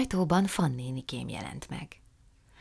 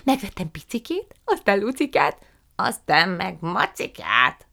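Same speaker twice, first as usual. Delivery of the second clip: put-on effusive old aunt; 4 semitones higher, 7 dB louder than usual. A narrator's reading. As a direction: stretch every word with delight. Aunt Fan's voice.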